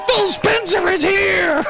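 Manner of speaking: high, slow mock announcer shout; Major Nelson. Streamer mimicking the host's guest reveal, hammed-up announcing. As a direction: draw it out in a hammed-up hype voice. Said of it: "Major Nelson goes".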